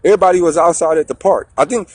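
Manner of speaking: normal talking voice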